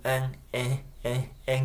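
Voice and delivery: "and" reduced to a tiny "en", D dropped; short unstressed "and" with no D; very weak "and". Shown as a demonstration. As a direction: shrink "and" down to a weak "en". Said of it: "すごく弱く".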